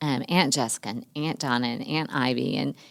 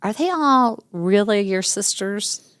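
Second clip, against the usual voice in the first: put-on high, slow, doubtful child's question; puzzled child; 6 semitones higher, slower than usual. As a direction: ask it like a confused kid. Daughter asks at home.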